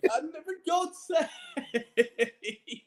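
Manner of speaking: high-pitched giggling through the words; helpless laughing. Real, not acted. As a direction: laugh while protesting. Laughing at teasing.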